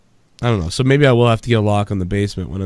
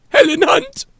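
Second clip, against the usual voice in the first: very high, squeaky mock call of her name; squeaky Helen Hunt; slower than usual. Friends joking about a movie, mocking a high squeaky name call. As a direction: squeal the name like a cartoon puppet.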